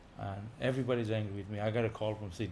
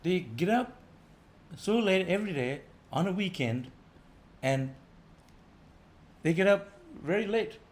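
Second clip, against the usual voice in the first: animated preaching; 6 semitones higher than usual. Real, not acted. Animated contrast with late risers.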